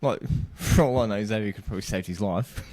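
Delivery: normal talking voice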